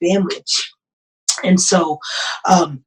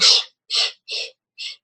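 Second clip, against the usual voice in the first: soft hushing shh; husband calming his wife with a soft shush; soothing shush; 5 dB quieter than usual. Retold or imitated. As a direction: hush her gently like a child. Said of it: "He was like".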